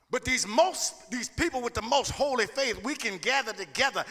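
building to a shouted preach; preaching climax. Acted. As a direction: rise to a loud preaching peak.

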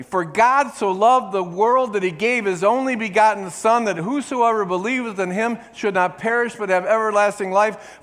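loud, high scripture recital; congregation-led verse; recited scripture. Shown as a demonstration. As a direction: lead the church in reciting. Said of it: "and say it with me".

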